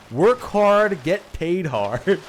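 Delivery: hammed-up rallying cry; mock slogan. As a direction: announce it like the ideal corporate motto. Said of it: "unless that's a fucking slide".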